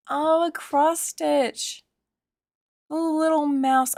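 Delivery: ordinary talk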